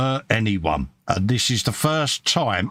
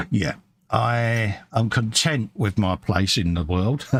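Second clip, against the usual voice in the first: ordinary talk, nothing marked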